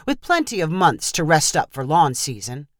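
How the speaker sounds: plain reading voice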